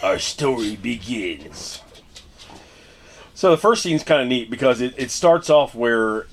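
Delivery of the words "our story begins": slow, drawn-out storybook opening; hammed-up, very slow story opener; dramatic storyteller. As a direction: stretch it out like a portentous narrator. Repeats the line as storyteller.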